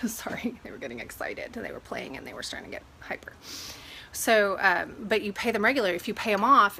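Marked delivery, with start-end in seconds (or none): 0.1-3.3 whispered aside, much softer; hushed sorry, much lower and softer; real whisper. Real, not acted. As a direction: breathe it out under the dogs' noise. Aside while dogs play nearby.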